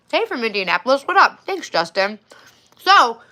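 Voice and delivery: ordinary talk, nothing marked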